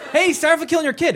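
high, fast, cheery mock apology. Human breezily apologizing to the cow, high and fast. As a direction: toss off a breezy fake sorry.

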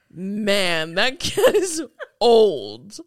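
slow, loud, drawn-out line; hammed-up, heavily drawled delivery; overdramatic quote. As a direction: stretch it out for comic drama. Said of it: "obviously I'm j-, being dramatic".

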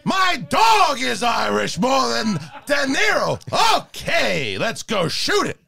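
hammy movie-star rant with stretched, high outbursts; Al Pacino. Acted. Actor complains about his co-star before filming, ranting Pacino impression. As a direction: lurch from clipped beats into drawn-out yells, then settle. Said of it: "Pacino just went from being like that really high voice".

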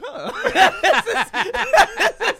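laughing mock exec. Toshiba imagined reading sales.

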